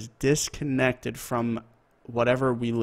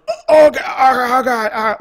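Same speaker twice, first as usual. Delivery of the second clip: high, loud wailing cry of a man shot; mock death wail, pitched way up; imitated dying groans; 12 semitones higher, 12 dB louder than usual. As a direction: cry out in agony, acting out the victim. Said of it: "drops onto the ground ... onto the stairs, going".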